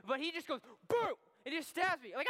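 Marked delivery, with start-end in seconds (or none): 0.9-1.2 loud, high burst for a stab; stab sound effect. Retold or imitated. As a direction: punch out a sudden jab noise. Acting out the pencil stab.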